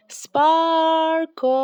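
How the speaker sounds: high, loud call across a field; girl calling a lost pet. A narrator's reading. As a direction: call his name into the distance. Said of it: "she called and called".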